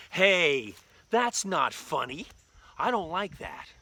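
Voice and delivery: drawn-out put-out complaint; mock indignation. Victim to the laughing daddy, indignant. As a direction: object like an offended prank victim.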